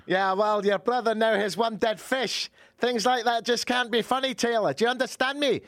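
high, hammed-up accented telling-off; scolding partner. Partner scolding Taylor over the fish, high indignant accent.

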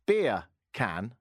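BEER, pause, CAN as two words; separated words. Shown as a demonstration. Host demonstrating an accent trick to listeners, "Beer can" spelt out separately. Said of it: "if you say that quickly".

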